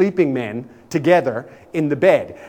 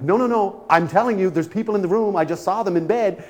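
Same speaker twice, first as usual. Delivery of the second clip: insistent protest; 6 semitones higher than usual. Retold or imitated. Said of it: "I'm like".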